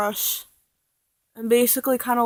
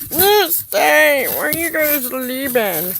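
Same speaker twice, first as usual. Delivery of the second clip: calling out; 4 semitones higher, 7 dB louder, slower than usual. Real, not acted. Calling out to falling things.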